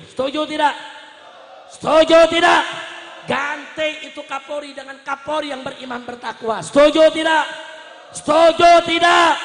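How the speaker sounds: full-force rally cry with stretched words; rallying shout. Real, not acted. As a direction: bellow for the crowd's agreement. Calling crowd for agreement.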